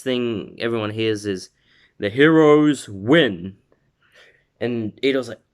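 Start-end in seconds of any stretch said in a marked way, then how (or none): 2.0-3.6 loud, slow, higher-pitched proclamation; announcer call. Retold or imitated. Everyone hears the result announced, loud slow announcer call.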